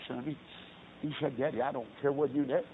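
ordinary talk, nothing marked

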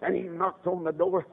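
ordinary delivery

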